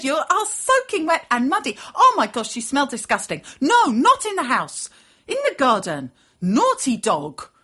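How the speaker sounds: loud, high outraged telling-off; disgusted scolding. A narrator's reading. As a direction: tell the dog off loudly, appalled. Girl scolds muddy dog, sends it out.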